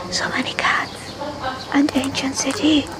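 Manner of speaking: soft happy whisper. Real, not acted. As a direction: whisper it contentedly. Whispering among cats.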